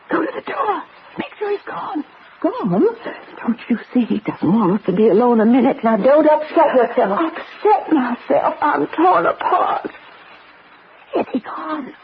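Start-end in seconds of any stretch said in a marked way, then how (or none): 0.1-2.0 softer, urgent instructions under the breath; urgent hush. Acted. Invalid wife urging Becky to check her husband has gone, hushed and urgent. 11.1-11.9 softer, frightened, close to tears; fearful whisper. Acted. Frightened wife asking Becky whether her husband has left, tearful hush.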